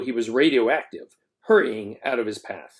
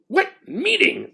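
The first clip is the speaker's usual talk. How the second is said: demanding; slower than usual. A narrator's reading. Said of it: "the principal demanded".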